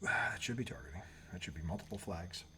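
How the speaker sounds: whispered aside at the game; hushed aside. Real, not acted. Watching the game, a whispered comment. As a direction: whisper it under your breath.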